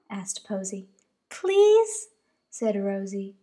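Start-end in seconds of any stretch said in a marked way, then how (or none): 1.3-2.1 high, pleading little bunny. Acted. Rosie pleading with Mama to stay outside, high bunny voice. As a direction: beg like a small child bunny.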